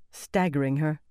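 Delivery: ordinary delivery, nothing marked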